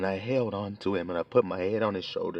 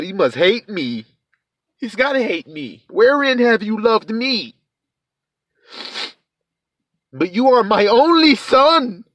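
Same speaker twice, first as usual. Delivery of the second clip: anguished voice; 13 semitones higher, 11 dB louder, slower than usual. Acted. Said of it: "And so you thought".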